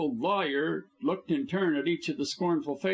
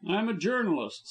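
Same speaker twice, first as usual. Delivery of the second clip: enraged; 7 semitones higher than usual. A narrator's reading. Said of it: "enraged at the man's impudence".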